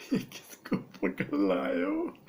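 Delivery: slow despairing whisper; exasperated whisper. Acted. Director whispering his despair about the hero. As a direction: breathe it out in despair.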